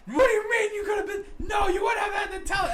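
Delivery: high, loud outraged yelling; angry shout. Retold or imitated. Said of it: "And he was like".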